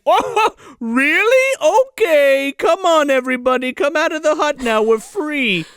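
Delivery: high, loud, hammed-up happy relief; imitating a gleefully freed Santa; mock delight. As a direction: call everyone out in mock excitement.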